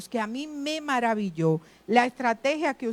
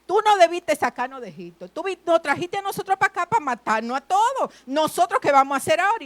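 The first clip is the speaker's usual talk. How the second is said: desperate complaint; 6 semitones higher, 4 dB louder, faster than usual. Retold or imitated. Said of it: "ellos le empiezan a decir a Moisés".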